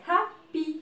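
HAPPY broken into two separate beats, hap, py; split into syllables. Shown as a demonstration. Teacher showing learners two syllables, happy split hap, py. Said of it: "So here we have two syllables".

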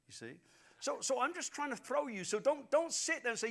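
normal talking voice

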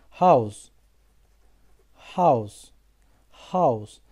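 House with a buzzing z at the end; z sound in house. Shown as a demonstration. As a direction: model House ending in z, not s. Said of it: "Bak Z'ye dönüştü".

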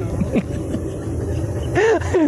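a soft unvoiced laugh; breathy laugh. Real, not acted. Presenter laughing during bloopers, soft laugh.

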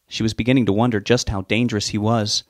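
ordinary delivery

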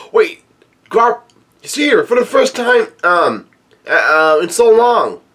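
gasp, then pipe up high in astonishment; high-pitched excited crowd member; excited onlooker's cry. Acted. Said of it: "somebody yells out".

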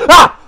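short, high loud shriek; startled scream. Real, not acted. Gamer spooked by dark Oswald, startled scream. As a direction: scream at a sudden jump scare. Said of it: "Why did that scare me so much?".

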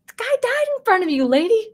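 high, fast incredulous outburst; exasperated. Real, not acted. Vlogger scolding the character in high disbelief. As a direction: throw up your hands at her. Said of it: "exasperated outburst".

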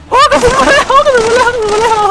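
panicked squeal. Real, not acted. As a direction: squeal as the bullets come. Panic as bullets fly. (ร้องเสียงสูงตื่นตระหนก)

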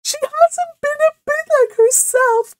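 very high, loud wailing sobs; hammed-up tearful outpouring; sobbing. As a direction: wail through tears, stretching the last words. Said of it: "she sobbed".

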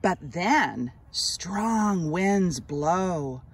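put-on slow, expressive read-aloud for children; gentle storybook narration, drawn out; story narration. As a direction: read it like a picture book to little kids, lingering on each line. Reads picture book aloud.

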